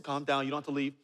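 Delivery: ordinary talk, nothing marked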